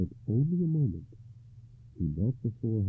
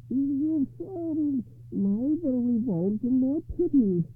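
high, very slow character voice; slow, high-pitched hag lady, musing; thoughtful monstrous bride; 6 dB louder, much slower than usual. A narrator's reading. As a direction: draw it out high and deliberate.

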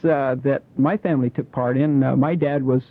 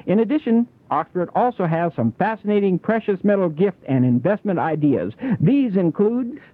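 ad read; 4 semitones higher than usual. Acted.

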